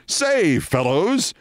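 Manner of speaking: high, swooping "fellows" greeting; hearty call to the guys, lilting up at the end; Scott's hail. Acted. Producer who calls men fellows, lilting genial hail. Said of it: "who would call a group of men fellows, as in".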